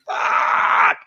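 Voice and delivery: breathy whispered swear under the breath; whispered curse. Retold or imitated. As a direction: hiss the curse out, stung by the pain. Ladle hits him in the eye.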